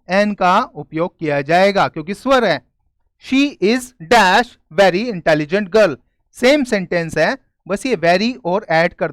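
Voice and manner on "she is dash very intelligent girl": very slow, word by word; slow dictation. Shown as a demonstration. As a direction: read it out slowly for the class to copy. Reading a fill-in sentence aloud. (धीमी, शब्द-दर-शब्द पढ़ाई)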